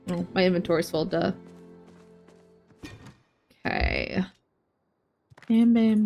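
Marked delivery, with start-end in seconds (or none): none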